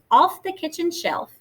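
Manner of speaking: usual speaking voice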